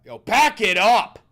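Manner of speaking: slow, high shouted yell; loud shout. Retold or imitated. Repeating the misheard line as a big yell. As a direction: belt it out slowly. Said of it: "I don't even know how I said it".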